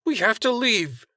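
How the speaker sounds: high, urgent plea to go; urgent Telford. Acted. Telford urging Stan to leave, high and urgent.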